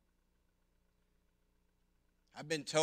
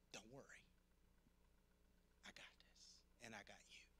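soft, near-whispered reassurance; hushed comfort; 4 semitones lower, 26 dB quieter than usual. Pretend. God comforting a worried believer, whispered. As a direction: whisper it like holding a child.

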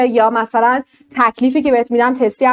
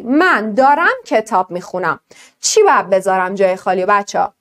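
usual speaking voice

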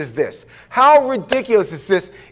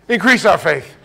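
louder plea; 4 dB louder than usual. Retold or imitated.

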